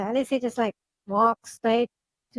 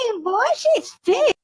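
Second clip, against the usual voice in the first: excited exclamation; 10 semitones higher, 4 dB louder than usual. Real, not acted. Player reacts to creature picture.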